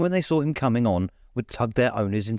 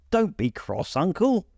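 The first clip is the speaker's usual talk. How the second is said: coaxing nephew; 8 semitones higher than usual. A narrator's reading. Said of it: "said the nephew".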